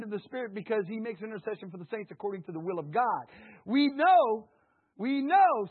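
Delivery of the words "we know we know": high, loud repeated cry; preaching cry. Real, not acted. Preacher proclaiming to the church, loud and high. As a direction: punch it out twice.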